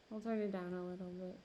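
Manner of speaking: ordinary delivery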